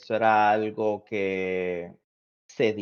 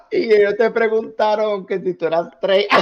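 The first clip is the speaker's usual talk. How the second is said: loud, high, laughing retelling; laughing while talking; 11 semitones higher, 7 dB louder than usual. Real, not acted. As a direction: tell it through real laughter. Laughing while recalling bar story. (hablando entre carcajadas)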